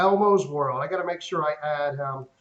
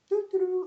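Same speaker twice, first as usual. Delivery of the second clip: high, sing-song doo doo doo; idle humming. Retold or imitated. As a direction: hum like you're waiting around. Imitates sitting idle.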